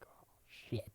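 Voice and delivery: whispered, caught off guard; a breathless oh shit; stunned whisper. Retold or imitated. Dad stunned by insult.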